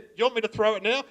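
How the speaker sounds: put-on eager young kid. Young mate voiced in story.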